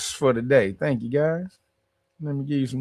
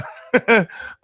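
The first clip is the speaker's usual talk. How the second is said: laugh; 7 semitones higher, 4 dB louder than usual. Real, not acted. Laughs at own joke.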